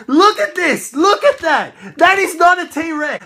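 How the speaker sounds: loud, high furious shouting; outraged yelling. Real, not acted. Collector yelling at the inaccurate dinosaur, loud and high. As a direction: yell at the toy in outrage.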